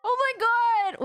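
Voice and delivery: loud, high oh my god in real shock; shocked outburst. Real, not acted. Player stunned by the twist on screen, shocked outcry. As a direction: blurt it out, genuinely stunned.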